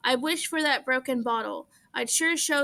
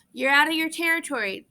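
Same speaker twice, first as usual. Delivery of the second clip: ordinary delivery, nothing marked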